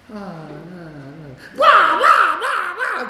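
loud, high squawking wah wah, half laughing; squawking swans. Retold or imitated. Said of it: "it's like swans on a lake".

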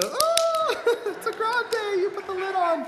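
very high, drawn-out squeal; mock gushing praise. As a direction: gush absurdly over a trivial feat. Mock applause for a barista.

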